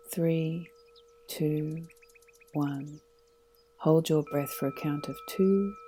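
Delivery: calm, unhurried meditation guide voice; guided meditation. Acted. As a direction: lead them gently into stillness.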